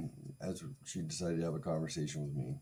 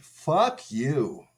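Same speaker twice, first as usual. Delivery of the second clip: loud, higher, furious rant at the ex; angry outburst; 3 semitones higher, 5 dB louder than usual. Retold or imitated. Rejecting the ex's friendship offer, loud angry rant. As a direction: tell her off with hammed-up rage. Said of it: "and I said".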